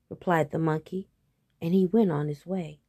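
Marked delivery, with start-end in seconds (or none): none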